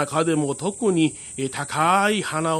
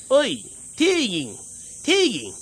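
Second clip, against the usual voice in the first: high, cheeky calling voice; small tengu calling; 6 semitones higher than usual. Acted. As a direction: call up from a treetop like an imp. 小天狗が木の上から呼ぶ. (高い声で呼ぶ小天狗)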